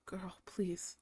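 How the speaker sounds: breathy no-voice plea; exasperated whisper. Retold or imitated. Pleading with her friend about bad company, whispered. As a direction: whisper it in disbelief.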